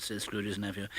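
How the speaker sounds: ordinary delivery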